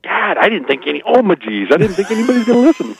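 high, fast, giggly admission; laughing confession. Real, not acted. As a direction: blurt it out laughing, caught off guard. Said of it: "My bad".

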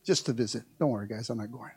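low, soft chuckling aside; laughing. Real, not acted. Pastor joking to church, low soft laughing aside. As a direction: laugh it off as a joke. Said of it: "I'm just playing".

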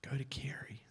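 "Go to Carey" whispered softly; whispered inner voice. Retold or imitated. God's small voice calling him again, whispered. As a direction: breathe "Go to Carey" like a still small voice. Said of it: "I hear this voice again".

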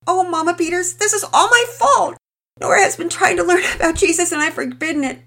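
high, loud, tearful pleading confession; emotional breakdown. Acted. Mother confessing her fault to neighbour, tearful and pleading. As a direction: pour it out on the edge of sobbing. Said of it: "trailed off in emotion".

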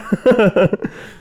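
high, giggling laugh at his own confusion; laughing at himself. Real, not acted. Recalling meeting a stranger, real laughing.

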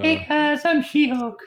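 put-on high falsetto girl voice; hammy high lady impression; man doing a woman's voice. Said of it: "talking with the girl voice".

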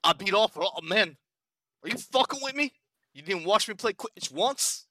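put-on high, loud New Zealand accent. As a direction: Kiwi accent, mock outraged. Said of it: "really good, uh, New Zealand accent".

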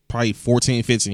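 ordinary talk, nothing marked

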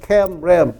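Kemrem said with e vowels, KEM-rem; demonstrated pronunciation. Shown as a demonstration. Preacher modelling Kemrem for the congregation to repeat, clearly. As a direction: model Kemrem with short e sounds. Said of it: "Đọc theo tôi".

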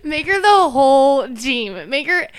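loud, high, drawn-out mock excitement; imitating drunken glee. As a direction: stretch it out like a tipsy brainwave. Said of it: "they're just crying, laughing".

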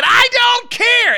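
high, drawn-out mock yell; hammed-up radio catchphrase; shock-jock catchphrase shout. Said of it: "And then I immediately said".

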